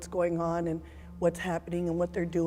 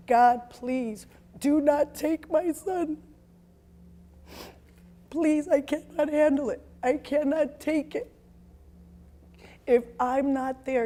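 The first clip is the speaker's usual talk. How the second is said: high, loud, slow anguished pleading to God; tearful loud prayer on her knees; desperate prayer; 6 semitones higher, 7 dB louder, slower than usual. Retold or imitated. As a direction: beg God not to take your child. Mother praying for son's life.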